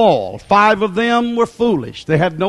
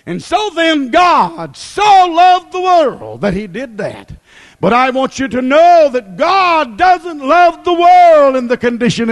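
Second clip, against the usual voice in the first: preaching; 4 semitones higher than usual. Acted. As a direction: drive it home to the church. Preacher building again.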